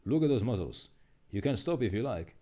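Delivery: usual speaking voice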